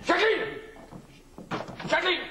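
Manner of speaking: high, sharp summons; imperious high-pitched call. Acted. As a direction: call your wife in from another room. Husband summons his wife.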